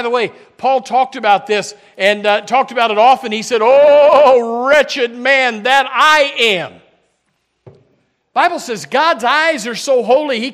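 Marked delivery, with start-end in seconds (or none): 3.6-6.8 loud, slow cry of lament; Paul's lament. Retold or imitated. Pastor voices Paul crying out over his sin, loud and slow. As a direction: drag out wretched man like a groan.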